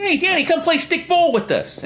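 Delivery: very high, hammed-up Brooklyn voice; squeaky Brooklyn street-kid call; Brooklyn accent. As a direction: shrink the twins into high-pitched Brooklyn kids. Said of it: "do it in the Brooklyn accent".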